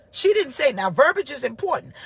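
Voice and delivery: normal talking voice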